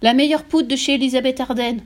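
outraged over the spilt powder; cri outré. A narrator's reading. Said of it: "hurla la mère". (reproche crié, outré)

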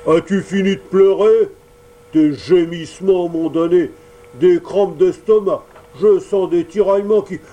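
grumpy showman yelling, sneezes breaking through; gruff shout. Acted. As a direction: bark at the crying puppet. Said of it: "cria à Pinocchio".

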